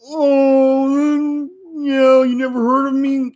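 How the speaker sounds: high, slow, mocking needy whine. Star-Lord begging the villain for approval, mock whiny. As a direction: beg for approval in a sing-song whine. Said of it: "your hero starts becoming".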